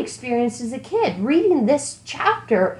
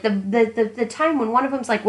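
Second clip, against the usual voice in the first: ordinary talk, nothing marked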